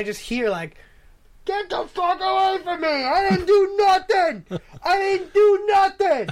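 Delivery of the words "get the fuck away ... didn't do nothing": high, loud, wailing yell; frantic high shout of denial; screaming old man. Retold or imitated.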